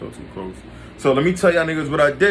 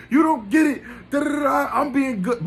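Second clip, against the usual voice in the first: very high falsetto whine, not shouted; anguished protest; 13 semitones higher than usual. Retold or imitated. Hurt boyfriend insisting he was being good, anguished high whine. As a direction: imitate your own frustrated pleading, pitched way up.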